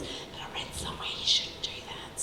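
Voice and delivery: whispered confiding aside; stage whisper. Real, not acted. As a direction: lean in and whisper it to the audience.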